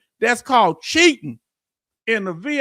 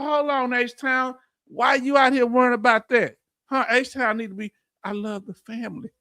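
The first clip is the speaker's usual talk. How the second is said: ordinary talk, nothing marked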